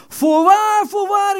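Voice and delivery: high, ringing emphatic voorwaar; solemn proclaiming. Acted. Preacher quoting Jesus in John five, ringing out. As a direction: lift the voice and declare it.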